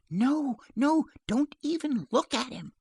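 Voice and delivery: high, urgent plea; alarmed protest. Acted. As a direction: stop him in high-voiced alarm. Mother urgently forbids shooting.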